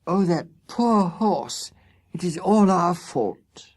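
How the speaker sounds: put-on a young girl grieving over the fallen horse; sweet pitiful girl. A narrator's reading. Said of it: "that sweet, pitiful voice saying".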